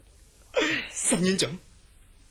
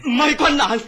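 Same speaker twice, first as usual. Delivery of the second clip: anxious insistence; 6 semitones higher, 7 dB louder than usual. Acted. Patient corrects the doctor.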